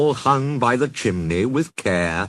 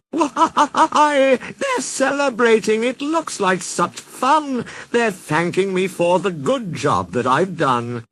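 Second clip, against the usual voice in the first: much higher, delighted and naive; delighted Jack; 9 semitones higher than usual. Acted. Jack looking down at the commotion, delighted and naive. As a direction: beam with misplaced pride.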